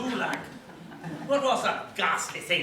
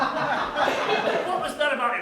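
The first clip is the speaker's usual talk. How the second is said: laughing; 7 semitones higher, 4 dB louder, slower than usual. Acted. Teasing a flustered friend.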